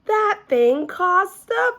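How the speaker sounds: high, loud and slow; called out. A narrator's reading. Shirley calling out to the class, high and loud. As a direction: call it across the room. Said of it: "called Shirley".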